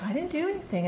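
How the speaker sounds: high mock-innocent denial; innocent kid. Teacher imitating an ODD child's denial, high pitched. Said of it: "'cause they're like".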